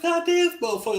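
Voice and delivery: high-pitched awed exclamation; amazed at Wemby. Retold or imitated. Fan reacting to Wemby's height, awed high cry. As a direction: gasp it out in amazement. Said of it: "I was like".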